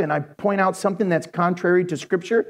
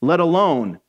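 ordinary delivery

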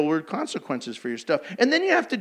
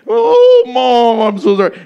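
high, very loud mock wail; hammed-up wailing apology; 5 semitones higher, 11 dB louder than usual. Child wailing an apology to mom, mock wail, high and loud. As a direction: blubber it out like a kid caught red-handed.

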